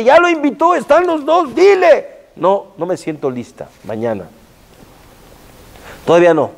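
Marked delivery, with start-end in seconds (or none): 0.0-2.1 rising higher and higher, pressing her to speak; urging Esther on, higher each phrase; urgent urging. Acted. 2.3-4.2 softer and lower, holding back; hesitant softness. Acted. Esther voiced hesitating, softly.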